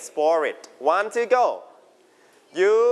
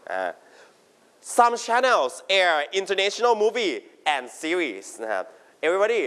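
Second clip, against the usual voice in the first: ordinary talk, nothing marked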